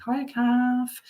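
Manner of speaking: very high put-on greeting; comedy catchphrase. Greeting Kathy with the comedy line, mock sitcom voice. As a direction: imitate a sitcom hello, pitched right up. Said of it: "that comedy".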